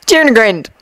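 ordinary delivery

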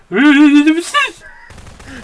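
loud high cackle; loud burst of laughter. Real, not acted. Big laugh.

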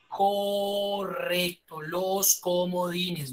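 very slow, stretching each word; drawn-out approval. Real, not acted. Teacher confirming a student's answer about wildcards, slow drawn-out approval. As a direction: draw out each word to savor the right answer.

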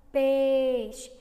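Peixe loud, stressing the pe; sounding out peixe. Shown as a demonstration. Teacher isolating the first sound of Peixe. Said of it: "ó".

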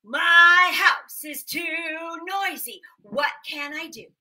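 loud slow sung complaint; hammed-up pleading old man; singsong old man. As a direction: sing the complaint, despairing.

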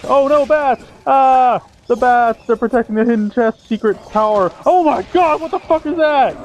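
high, hammed-up panicked shrieks; mock panic. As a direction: overact terror at the bats. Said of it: "I will act surprised anyway".

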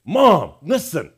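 loud, high mock child demanding attention; insistent child. Child barging in on a parent, loud mock insistence. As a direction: imitate a kid barging in, loud and drawn out. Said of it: "They come barging into the room and say".

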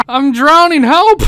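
high loud laugh into a mock cry for help; laughing shriek. Real, not acted. Player drowning in the bath, laughing and yelling for help. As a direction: howl with laughter, then yelp for help.

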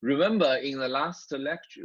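normal talking voice

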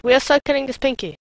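ordinary talk, nothing marked

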